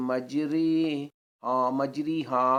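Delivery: مجری ہا said with a long "ee" after r; wrong "ree" vowel. Shown as a demonstration. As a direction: demonstrate the mistake: "majree-haa" for مجری ہا. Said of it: "پڑھنا غلط ہے". (مجری ہا میں را کے نیچے پوری ی)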